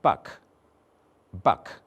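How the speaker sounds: "Buck" twice, short central uh vowel; vowel demo. Shown as a demonstration. Teacher modelling the vowel in buck. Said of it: "Then I said".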